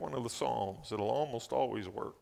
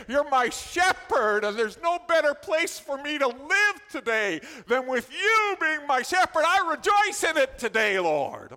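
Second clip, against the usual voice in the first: much higher and louder, shouting praise; excited shouted prayer; 11 semitones higher, 9 dB louder than usual. Real, not acted. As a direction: let the worship erupt into a full shout. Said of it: "Boy, I'm getting excited".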